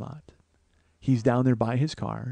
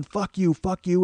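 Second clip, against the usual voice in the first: higher-pitched retold cursing, little extra volume; mock yelling dad; 6 semitones higher, slower than usual. Dad cursing back at mom, mock yelling. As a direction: imitate an angry dad swearing back, held in. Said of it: "And he's yelling".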